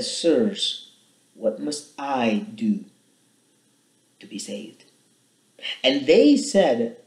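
measured, dramatic Bible reading; scripture reading. A narrator's reading. Preacher reading Acts to his congregation, measured and dramatic. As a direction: read the passage like it matters. Reads Acts passage aloud.

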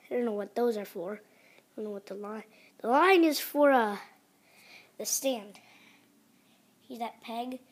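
ordinary talk, nothing marked

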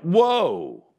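a sudden dawning, voice up and loud; surprised realization. Retold or imitated. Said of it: "I went".

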